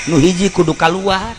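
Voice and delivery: usual speaking voice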